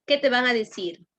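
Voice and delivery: usual speaking voice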